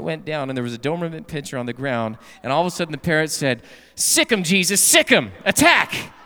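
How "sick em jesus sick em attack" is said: high, loud punchline bark; loud attack command. Acted. Parrot sets the Doberman on the thief with a loud command. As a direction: yell the order to the dog.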